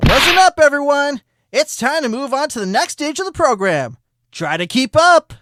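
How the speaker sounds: rally the room like an aerobics coach; fitness coach. Acted. Flash addresses courtroom like coach.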